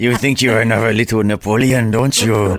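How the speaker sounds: teasing, put-on French accent; mock French accent. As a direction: tease in a hammed-up French accent. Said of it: "speak with the French accent".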